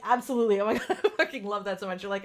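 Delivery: normal talking voice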